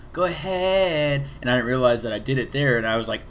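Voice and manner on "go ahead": "Go ahead" said very slowly and higher; drawn-out catchphrase. Retold or imitated.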